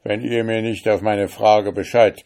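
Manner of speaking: loud, high, domineering threats; threatening judge. Acted. Richter droht dem Fremden. (laut drohender Richter)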